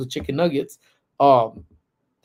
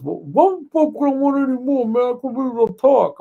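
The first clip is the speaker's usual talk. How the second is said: much higher, louder, indignant mimic of a cousin with puffed-up lips; swollen-lip outrage; 11 semitones higher, 5 dB louder than usual. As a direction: fire it back with attitude through swollen lips. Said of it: "you don't have to give me attitude".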